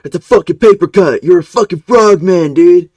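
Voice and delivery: loud, high taunting yell; mocking shout. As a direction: belittle him, shouting. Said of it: "shit-talking Sam".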